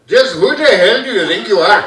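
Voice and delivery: put-on loud Indian-accented dad; mock Indian father demanding an answer.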